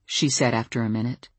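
ordinary delivery, nothing marked

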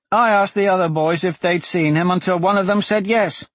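put-on high little boy explaining himself; small boy. A narrator's reading. Small boy tells how he found George, high boyish voice. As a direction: chatter it out high, like a young lad.

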